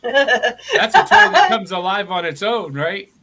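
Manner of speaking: laughing through the words. Real, not acted. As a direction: giggle out the line. Joking while laughing.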